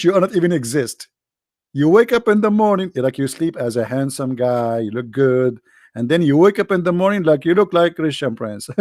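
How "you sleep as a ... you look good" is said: ordinary delivery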